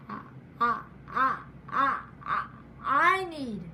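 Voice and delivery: repeating the word I; real stutter on I. Real, not acted. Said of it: "my stutter".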